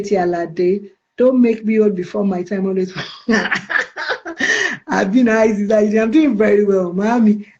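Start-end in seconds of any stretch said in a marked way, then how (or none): 3.0-4.8 a high, breathy burst of laughter. Real, not acted. Laughing at a viewer's teasing comment, high laugh.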